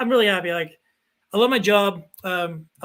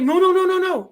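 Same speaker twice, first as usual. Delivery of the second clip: higher, louder run of quick no's; urgent protest; 8 semitones higher, 5 dB louder than usual. Retold or imitated. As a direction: wave it off as a misunderstanding. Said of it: "And he's like".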